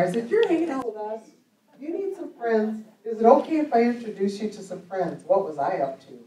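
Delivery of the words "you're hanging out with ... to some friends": higher, louder playful nudge; teasing. Retold or imitated. Preacher teasing the young woman about finding some friends, playfully high. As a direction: tease her with a raised, playful pitch. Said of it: "But I was teasing her".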